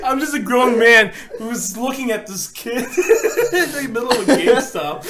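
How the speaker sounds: slow, loud words broken by laughter; can barely get it out for laughing. Real, not acted. Grown man laughing at himself in GameStop, loud slow giggling.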